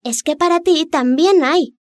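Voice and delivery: high, urgent fish voice; urgent little fish. Acted. Little fish warns the Rex.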